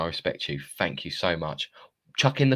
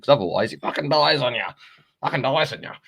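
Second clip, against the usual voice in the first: higher-pitched, put-on Australian accent; 6 semitones higher, 5 dB louder than usual. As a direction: slip into a loud mock-Aussie accent. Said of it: "gone Australian again there".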